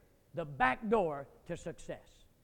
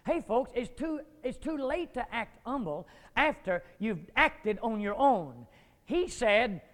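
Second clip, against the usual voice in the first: ordinary talk, nothing marked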